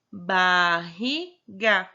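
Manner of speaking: very slow, stretched barriga; teacher sounding out barriga; syllable by syllable. Shown as a demonstration. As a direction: draw out barriga so the rr split is heard. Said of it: "Só que o R, a gente separa".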